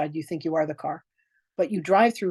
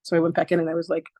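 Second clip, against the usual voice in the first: usual speaking voice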